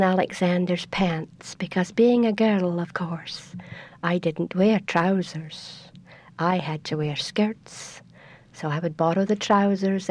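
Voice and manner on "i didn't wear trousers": ordinary delivery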